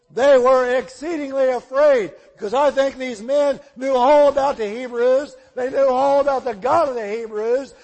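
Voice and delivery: loud, fervent preaching; full-force sermon delivery. Acted. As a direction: drive the story home to the congregation. Preacher retelling Jonah at full force.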